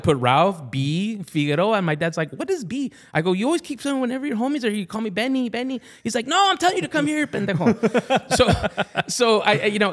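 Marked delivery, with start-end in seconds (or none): 2.3-2.9 higher, puzzled mock dad; lightly voiced confused father; dad asking. Dad asking son about initial B, higher. 3.3-5.8 put-on higher, faster childlike voice; mock innocent little boy; kid explaining. Son explaining Benny to dad, high childlike. 6.3-7.7 much higher mock exasperation; hammed-up fed-up father; exasperated dad. Dad correcting son about vení, high exasperated.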